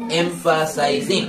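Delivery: এমফাসাইজিং slowly in pieces: em-fa-sai-zing; broken into syllables. Shown as a demonstration. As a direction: split এমফাসাইজিং syllable by syllable, drawn out. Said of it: "ভেঙে ভেঙে উচ্চারণ করবা".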